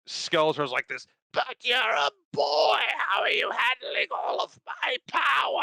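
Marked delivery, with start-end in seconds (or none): 1.3-5.6 high, strained villain shriek; mock Skeletor shriek; Mark Hamill Skeletor. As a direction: squeal it like a cartoon villain. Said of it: "I can't do a Mark Hamill".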